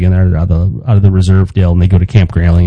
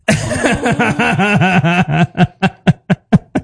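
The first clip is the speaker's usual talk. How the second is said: long rising laugh; 9 semitones higher, 3 dB quieter than usual. Real, not acted. Laughing at story.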